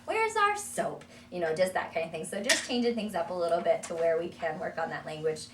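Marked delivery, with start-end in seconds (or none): 0.0-1.0 very high, loud "Oh, no, soap"; mock surprise. Shown as a demonstration. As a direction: pretend alarm for the toddler. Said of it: "wash him with a toy".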